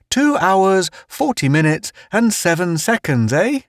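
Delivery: high, teasing gruff fellow; Town's voice. Acted. Town teases Pace about his sense of time, high and jokey. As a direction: needle him with a high, jokey voice.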